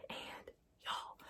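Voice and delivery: breathy conspiratorial whisper to the viewers. Real, not acted. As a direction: lean in and whisper it.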